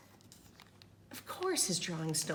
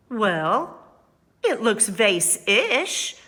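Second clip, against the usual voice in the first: loud, slow, drawn-out exclaim; 2 semitones higher, 11 dB louder, much slower than usual. Acted. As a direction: insist it brightly, stretching vase-ish. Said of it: "she exclaimed".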